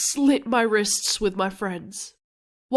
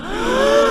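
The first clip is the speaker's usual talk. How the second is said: high sharp gasp; sudden gasp; shocked gasp; 11 semitones higher than usual. Acted. Shocked reaction to the threat.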